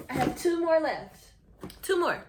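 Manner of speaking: excited. Real, not acted. Excited counting remaining books.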